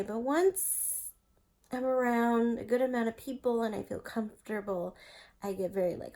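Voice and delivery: ordinary talk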